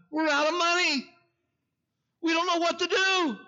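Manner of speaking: high, tearful falsetto imitation; imitating crying woman. Mayor imitating the crying young woman at his desk, high tearful voice. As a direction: squeak it out like a weeping young woman. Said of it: "That's the way she sounded".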